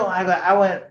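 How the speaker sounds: usual speaking voice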